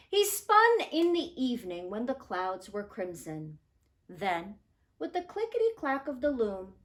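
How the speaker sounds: put-on expressive picture-book narration for children; gentle storyteller voice; storybook read-aloud. A narrator's reading. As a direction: tell it like a bedtime story.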